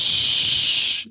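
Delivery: long, loud, drawn-out shushing hiss; forceful "shhh" to silence a crowd. Shown as a demonstration. Co-host showing the annoying sound to avoid, loud shush.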